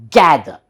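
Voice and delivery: "Gather" with a loud, punched "GA"; stress on GA. Shown as a demonstration. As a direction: hit "Ga" hard, drop the final r in "Gather". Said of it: "ga syllable should be hardly pronounced".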